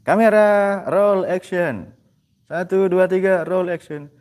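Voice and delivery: playful director shouting action; mock director. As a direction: bark it like a film director on set. Mock film director calling action.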